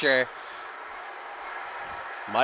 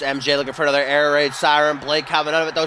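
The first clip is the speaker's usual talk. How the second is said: ordinary talk, nothing marked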